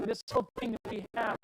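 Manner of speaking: ordinary talk, nothing marked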